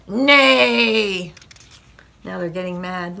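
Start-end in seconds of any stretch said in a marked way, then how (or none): 0.1-1.3 loud, long falling neigh; horse neigh. A narrator's reading. Horse getting mad at sleeping farmer, neighing loudly. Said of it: "the horse said".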